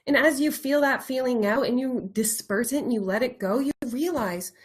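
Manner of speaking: normal talking voice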